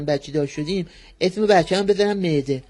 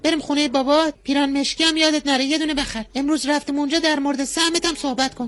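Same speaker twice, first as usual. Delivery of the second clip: higher, syrupy wife's voice; sugary wife; 7 semitones higher than usual. Acted. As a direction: coo at your husband like a sweet-talking wife. Wife comes to fetch husband.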